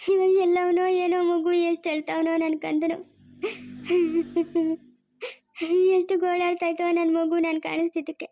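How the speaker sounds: tearful lament broken by sobs; weeping. Acted. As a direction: wail for a lost child, sobbing between phrases. Mother weeping for lost child. (ಅಳುತ್ತಾ ಗೋಳಾಡುತ್ತಾ)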